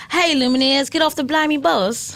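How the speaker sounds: lightly put-on British boyfriend voice; imitating a British boyfriend in a British accent; bad British accent. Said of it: "Do an impression of him".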